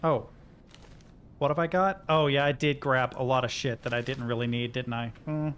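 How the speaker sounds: ordinary delivery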